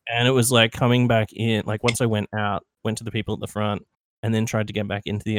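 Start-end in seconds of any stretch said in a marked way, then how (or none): none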